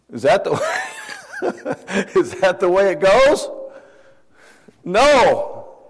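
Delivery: high laughing mock question; laughing disbelief. Real, not acted. Preacher to congregation, laughing disbelief. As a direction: laugh at the idea as you ask it. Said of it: "mocking the idea, laughing".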